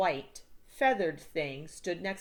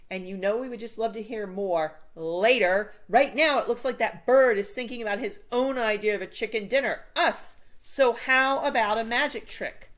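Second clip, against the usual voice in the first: louder, dry sarcasm; mock politeness with urgency; sarcastic Sam; 3 semitones higher, 7 dB louder than usual. A narrator's reading.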